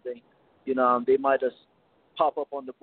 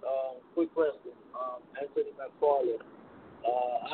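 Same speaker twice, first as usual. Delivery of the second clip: too quiet; 5 dB quieter, slower than usual. Real, not acted. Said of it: "Speak up into your phone. Can't hear you".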